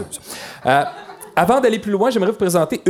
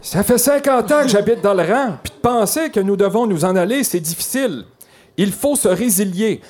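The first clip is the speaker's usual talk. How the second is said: higher voice of a longtime resident; another citizen voice; 5 semitones higher than usual. Acted. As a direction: put on a raised character voice. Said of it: "J'en ai une autre".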